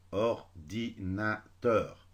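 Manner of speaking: "Ordinateur" cut into or-di-na-teur, slowly; robot syllable split. Shown as a demonstration. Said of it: "كشغل لو روبو". (« Ordinateur » découpé lentement, comme un robot)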